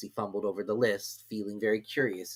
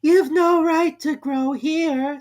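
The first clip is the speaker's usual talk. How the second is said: high, slow squeaky complaint; a small creature squeezed and cross; high, slow, loud Dormouse grumble; Dormouse complaining; 9 dB louder, much slower than usual. A narrator's reading. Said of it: "said the Dormouse".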